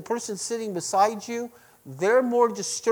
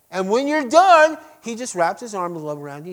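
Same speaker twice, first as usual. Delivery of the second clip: normal talking voice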